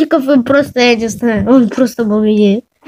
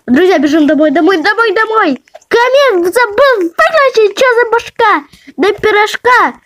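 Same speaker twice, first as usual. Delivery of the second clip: alarm shout; 9 semitones higher than usual. Real, not acted. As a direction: talk in a frantic panic. Panicked run home, fire.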